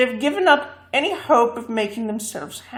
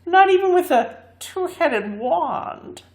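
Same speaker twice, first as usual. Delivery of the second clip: slow, higher scornful boast; defiant boast; 6 semitones higher, slower than usual. A narrator's reading. Bungle boasting to the man, scornful. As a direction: taunt with confidence. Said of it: "exclaimed Bungle".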